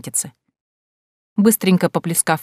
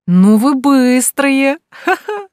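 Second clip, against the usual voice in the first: high, slow playful teasing with laughter; 8 semitones higher, 6 dB louder, much slower than usual. Acted. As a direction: tease her, then burst out laughing. Friend teasing about a lover. (дразнящий смех)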